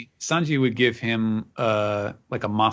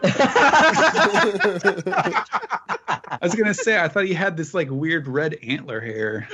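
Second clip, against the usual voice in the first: high, helpless laughter through the words; talking through a laughing fit; laughing hard; 8 semitones higher, 4 dB louder than usual. Real, not acted. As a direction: start high and loud, cracking up. Cracks up over the hat mistake.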